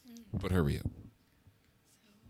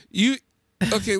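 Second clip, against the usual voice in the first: ordinary delivery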